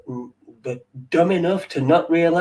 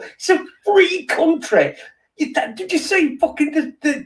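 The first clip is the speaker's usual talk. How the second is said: mock indignant retort; 10 semitones higher than usual. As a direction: toss it back like a hammed-up outraged snub. Imagined tirade, retort quoted.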